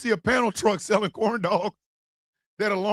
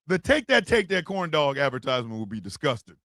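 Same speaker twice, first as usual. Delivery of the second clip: ordinary delivery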